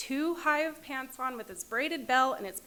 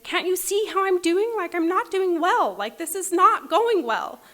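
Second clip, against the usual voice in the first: higher, louder, venting the frustration she held back; frustrated yell; 8 semitones higher, 10 dB louder than usual. Retold or imitated. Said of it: "And I just wanted to scream at them".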